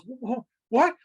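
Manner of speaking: a mock-stunned stutter on what; stunned stammer. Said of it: "the guy's looking at it stunned, shocked".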